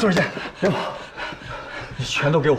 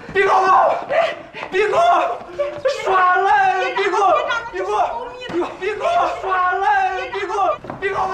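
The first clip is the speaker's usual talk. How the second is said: shrieking protest; 9 semitones higher, slower than usual. Acted. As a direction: squeal it over and over as you struggle. Struggling, repeated protest yells.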